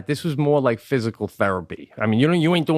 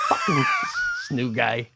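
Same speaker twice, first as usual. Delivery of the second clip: laughing; 3 dB quieter, slower than usual. Real, not acted. Laughing mock complaint.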